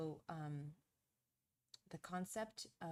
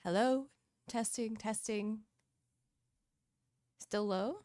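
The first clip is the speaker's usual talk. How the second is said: loud, raised testing voice; projected mic check; 4 semitones higher, 9 dB louder than usual. Real, not acted. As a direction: project up and louder into the microphone. Projecting for a microphone sound check.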